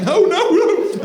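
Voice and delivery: high, loud, slow desperate no; high panicked no. Retold or imitated. Dad panicking as nap time dies, high slow desperate no. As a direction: plead in panic.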